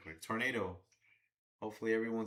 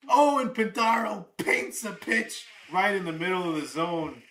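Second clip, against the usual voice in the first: high, loud play-by-play burst; excited shout; 9 semitones higher, 13 dB louder than usual. Real, not acted. Streamer calling the pitch live to chat, excited shout. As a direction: shout the big pitch as it happens.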